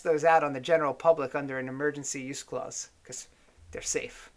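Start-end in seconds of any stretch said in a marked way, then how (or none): 3.0-4.3 whispered, mock-reassuring aside; sarcastic wink. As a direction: say it low with a knowing wink. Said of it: "I'm winking".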